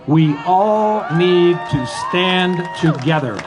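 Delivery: rousing protest cry; rallying shout. Real, not acted. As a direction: raise the voice to rally the crowd. Rally speech climax call to unite.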